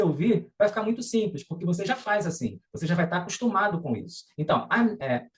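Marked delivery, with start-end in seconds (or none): none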